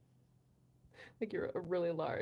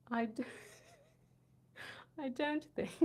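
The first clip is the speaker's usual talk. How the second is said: higher, mock British accent, breaking into giggles; 5 semitones higher, 4 dB quieter than usual. Reading a viewer's line on request, giggly British accent. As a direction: put on British, crack up halfway. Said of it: "say this in a British accent".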